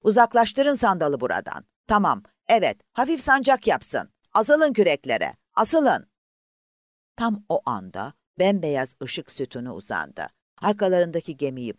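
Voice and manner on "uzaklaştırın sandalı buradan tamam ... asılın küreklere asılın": loud, high commands to the rowers; shouted orders. Acted. Officer yelling at the rowers to pull away, loud. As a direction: bark urgent orders over the noise.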